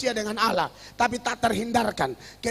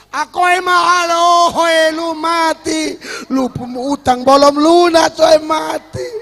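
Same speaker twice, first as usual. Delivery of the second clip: hammed-up wailing shout, much louder; a loud, mocking imitation of graveside keening; mock mourning wail; 4 semitones higher, 16 dB louder, slower than usual. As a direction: bellow it like a cracked-voice Batak mourner. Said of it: "memang pecah".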